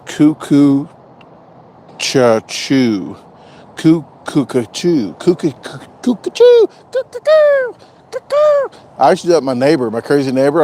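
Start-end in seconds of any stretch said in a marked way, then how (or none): 0.0-8.7 mock bird call. Quoting a viewer's bird call in falsetto. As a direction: hoot it like a cuckoo clock. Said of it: "D T says".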